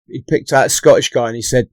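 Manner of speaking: usual speaking voice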